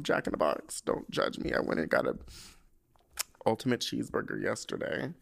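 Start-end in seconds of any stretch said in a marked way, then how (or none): none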